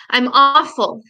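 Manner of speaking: crying hard; garbled crying. Retold or imitated. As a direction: push it out through tears, inarticulate.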